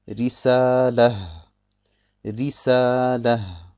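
Risalah langsam, mit hellem R; helles Ra. Shown as a demonstration. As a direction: sprich Risalah gedehnt, das R hell vor dem i. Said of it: "dann wird es hell ausgesprochen". (Risalah mit hell gefärbtem Ra)

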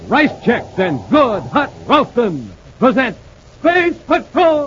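big slow show-opening call; dramatic announcer. Acted. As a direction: announce it like a radio serial.

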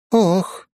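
high startled gasp; surprised gasp. A narrator's reading. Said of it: "охнула Фуори".

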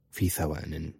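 plain reading voice

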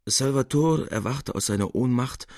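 ordinary delivery

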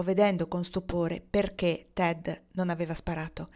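usual speaking voice

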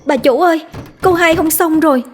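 high, hurried servant's cry; a panicked maid calling her mistress; urgent servant. Acted. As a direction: servant bursting in with bad news, high and urgent. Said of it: "giọng nói gấp gáp của cái tiếng người hầu".

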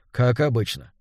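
plain reading voice

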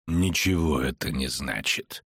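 low, slow, curt dismissal; low-pitched final rebuff; cutting. A narrator's reading. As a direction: cut them off in a low, measured voice. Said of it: "отрезал чародей".

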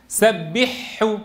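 slow "سبحه" with a doubled throaty ḥ; reverse merging error. Shown as a demonstration. Teacher exposing the mistake slowly, h merged into ḥ. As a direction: draw out "سبحه" as sabbiḥ-ḥu, no h. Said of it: "أدغم الهاء في الحاء".

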